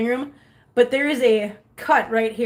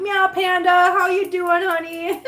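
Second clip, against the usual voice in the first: high, loud singsong welcome; bubbly sweet hello; gleeful greeting; 7 semitones higher, 5 dB louder, slower than usual. Real, not acted. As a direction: greet a friend with big high-pitched delight. Greeting a viewer with a meow.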